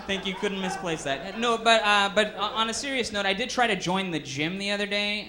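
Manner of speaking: ordinary delivery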